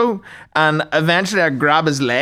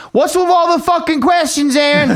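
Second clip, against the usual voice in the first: imitating a coach's high-pitched scolding; exasperated coach; 9 semitones higher than usual. Coach fed up with trainee's questions, mock angry outburst. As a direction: blow up at the trainee, hammed up.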